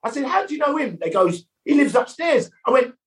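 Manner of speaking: high, incredulous yelp; disbelieving squeak at the roommate's news; shocked huh. Retold or imitated. Learning Fosse lives upstairs.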